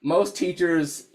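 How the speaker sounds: ordinary talk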